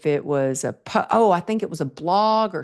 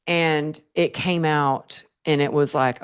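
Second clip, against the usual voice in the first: ordinary talk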